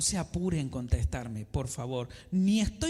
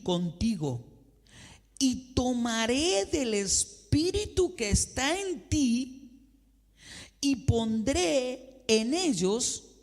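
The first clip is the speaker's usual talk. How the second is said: slow, louder, higher proclamation of the verse; solemn scripture reading; 7 semitones higher, 4 dB louder, slower than usual. Acted. Preacher reading Numbers eleven to the congregation, slow and solemn. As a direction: declaim God's words slowly like a pulpit reading.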